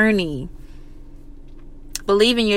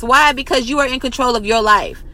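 ordinary talk, nothing marked

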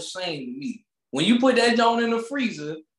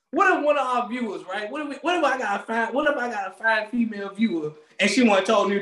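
ordinary talk, nothing marked

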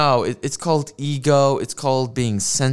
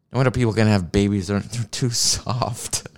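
ordinary talk

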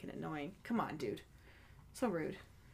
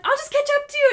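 excited call; 12 dB louder, faster than usual. Retold or imitated. Retold excited call while running.

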